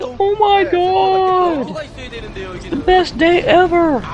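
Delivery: high, slow squeaky celebration; mock excited soldier. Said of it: "They're like".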